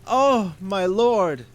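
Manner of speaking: drawn-out, high, loud wail; stretched-out begging cry; desperate cry for pardon. Acted. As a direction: plead slowly, terrified. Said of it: "cried the merchant".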